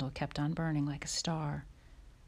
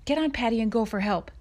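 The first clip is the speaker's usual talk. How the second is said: high, loud, pressing order; urgent plea; 5 semitones higher, 6 dB louder than usual. A narrator's reading. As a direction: urge him anxiously to get help. Ma urgently sends for help.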